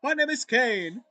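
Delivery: hammed-up grand circus host; villain ringmaster voice. Voicing Kane character.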